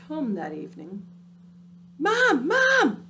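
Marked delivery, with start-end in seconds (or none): 2.0-3.1 high, loud cries for Mom; panicked scream. Acted. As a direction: shout for Mom in terror. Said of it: "Jasper screamed".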